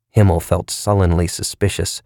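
ordinary delivery, nothing marked

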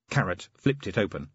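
usual speaking voice

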